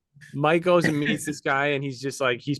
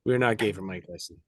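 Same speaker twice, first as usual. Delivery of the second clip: low, subdued mock-serious statement; mock-solemn disclaimer; 5 semitones lower, 4 dB quieter than usual. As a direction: set the record straight, playfully grave. Mock-serious disclaimer about Mike Tyson.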